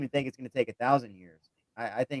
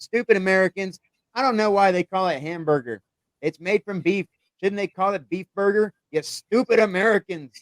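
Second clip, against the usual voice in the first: put-on grandmother's higher, louder rant in a hinted German-Danish accent; attempted German-Danish accent; 7 semitones higher, 5 dB louder than usual. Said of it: "in German slash Danish accent".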